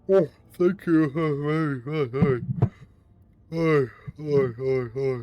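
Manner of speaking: hi's stretched through a yawn; yawning hello to the raiders; yawning greeting. Real, not acted. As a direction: greet them mid-yawn, drowsy. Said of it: "You just yawn into the microphone".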